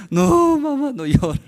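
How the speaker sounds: high, loud wailing plea to mother; imitated crying. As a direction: wail like a child begging mum, acted. (súplica aguda imitando llanto)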